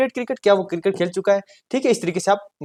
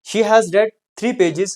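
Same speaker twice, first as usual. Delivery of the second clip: slow dictation; 3 semitones higher, much slower than usual. Shown as a demonstration. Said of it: "teacher dictates example".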